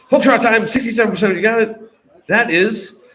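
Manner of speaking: loud, high-energy reveal; stall, then belt out the answer; host announcement. Real, not acted. Host reveals the answer to players, loud announcement.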